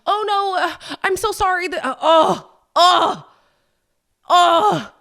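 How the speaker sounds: high, flustered fake apology; mock apologetic excuses. Partner making excuses to friends for him, mock apologetic. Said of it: "you constantly have to make excuses like".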